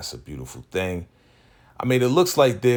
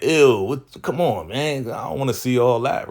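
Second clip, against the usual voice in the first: louder and higher, disgusted protest; grossed out; 6 semitones higher, 5 dB louder than usual. Retold or imitated. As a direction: whine it like a son seeing his parents kiss. Emmett grossed out by kissing.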